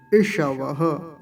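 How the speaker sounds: इषवः slow with retroflex ष and a final breath. Shown as a demonstration. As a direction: stretch इषवः, curling the ष. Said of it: "मूर्धन्य शकार का विशेष ध्यान रखेंगे". (इषवः मूर्धन्य ष के साथ)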